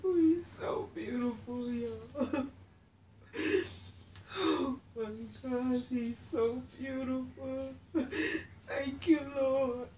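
real happy crying, high voice; overcome, crying through the words in gratitude; tearful joy. Real, not acted. Thanking the Lord for her beautiful baby, crying. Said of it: "I'm trying not to cry".

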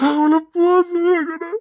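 crying out. Acted. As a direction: let the crying break through on போக. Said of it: "நான் கத்தி அழுதுருவேன்டா".

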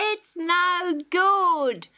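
a mocking old joker; chuckling kookaburra. A narrator's reading. Kookaburra teases the court, high and amused. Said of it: "laughed the kookaburra".

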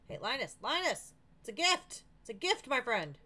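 high, loud call to a friend; playful, lifted hailing. Pretend. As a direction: call out eagerly to get attention. Calls to game character.

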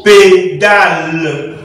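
"pédale" slowly split as pé, da, le; syllable by syllable. Shown as a demonstration. Teacher forming a word from three syllables, slowly sounded out.

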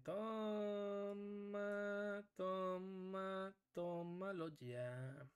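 slow sly taunt. Real, not acted. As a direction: savor each toma like a quiet gloat. Taunting the opponent.

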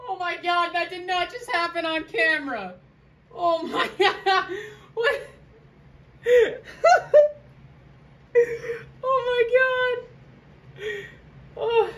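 high squealing laughter, half crying; shocked laughter. Real, not acted. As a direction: laugh in disbelief at the mishap. Figure's skirt falls off on camera.

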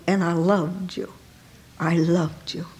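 soft, low, tender voice of God. Acted. God speaking softly to the soul, tender. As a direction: lay the words down gently as a loving God.

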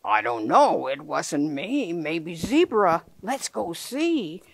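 low, slow lion voice; lion puppet shrugging slowly; Lion's voice. Acted. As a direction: answer slowly, deep like a big lion. Lion answers in book.

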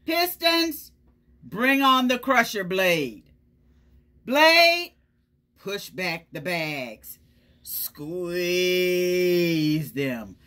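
a proud truck barking orders at its machinery; bossy garbage truck. Acted. Garbage truck ordering its crusher blade, bossy and loud. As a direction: boom out commands like a boastful truck.

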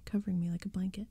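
ordinary delivery, nothing marked

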